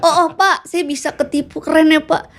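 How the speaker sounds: high, laughing mock wonder; mock amazement. To the man who cheated her, high laughing mock praise. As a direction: gush sarcastically through a laugh. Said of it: "mock reply to fraudster".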